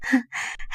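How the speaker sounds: small breathy chuckle; quick, quiet character laugh. A narrator's reading. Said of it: "ضحك عليها وقالت".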